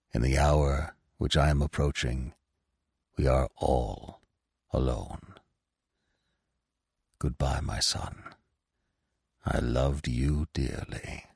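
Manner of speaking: a dying father's last tender words; tender farewell. A narrator's reading.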